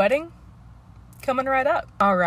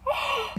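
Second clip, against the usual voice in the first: sharp gasp; 12 semitones higher, 7 dB quieter than usual. Real, not acted. Reacting to flower girl pick.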